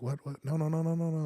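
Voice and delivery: mock-panicked disbelief, held high; freaking out, retold. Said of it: "I'm freaking out".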